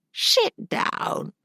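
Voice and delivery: high, airy crone rasp; an old woman's brisk order. Acted. Old woman orders Gerda to sit.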